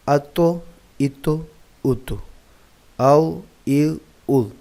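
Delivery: crisp, emphatic teacher recitation of each syllable; syllable drill. Shown as a demonstration. Teacher reciting jazm syllables for children, crisp and clear.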